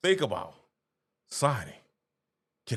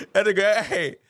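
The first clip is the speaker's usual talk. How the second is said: laughing; 2 semitones higher, 3 dB louder, slower than usual. Real, not acted. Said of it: "that took on me right there".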